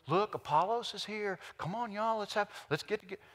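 high-pitched eager calling, not loud; mock excited crowd. Voices an eager crowd greeting Apollos.

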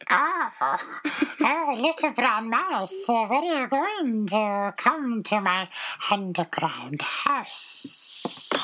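high, slithering snake, hissing the s; high snake voice with a long hissed ending. Acted. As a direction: draw it out like a sly snake. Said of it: "do you remember what my snake voice".

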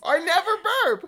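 high, loud, slow, laughing. Real, not acted. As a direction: insist through laughter. Laughing denial.

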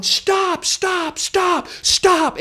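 high repeated cries of stop; pleading stop. Retold or imitated. Comic pleading with kids in a food fight, high cries. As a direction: beg the kids to quit. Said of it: "And I'm going".

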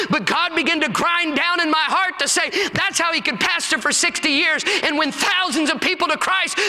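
preaching. Acted. Preacher rising to a climax before his congregation. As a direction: preach it up to a shout.

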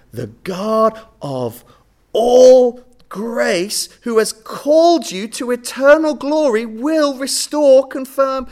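loud, slow, ringing pulpit proclamation; big revival sermon cadence; preaching at full force. Acted. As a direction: lift the hall with each word of promise. Preacher's climax on God's grace.

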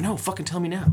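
impatient demand. Retold or imitated. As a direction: mimic a friend who can't wait to hear. Imitating impatient friend demanding story.

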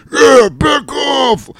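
slow, very high, loud mock bellow; mocking dim-witted vampire. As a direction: stretch it into an exaggerated goofy wail.

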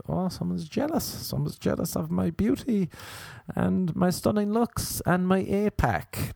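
high, slow, preening boast; vain show-off voice. Retold or imitated.

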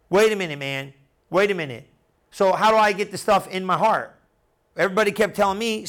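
ordinary talk

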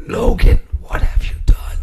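put-on whispered, frail old man; whispered Logan, what have you done; Charles Xavier impression. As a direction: breathe it out like a dying Professor X. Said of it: "Do your best, Charles Xavier".

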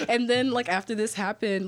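ordinary delivery

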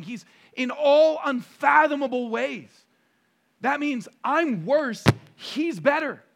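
high, loud, impassioned pulpit delivery; emphatic preacher knocking down each objection; full-force preaching. Acted. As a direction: preach hard, punching each key word.

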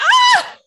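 loud high shriek of shock; shocked scream. Real, not acted. Opening the listener's photo, shocked high scream. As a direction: scream at the picture. Sees the butt crack photo.

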